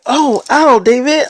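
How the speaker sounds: sudden high, loud yelp of hurt; imitated cry of pain. Girlfriend smacked in the eye cries out in pain.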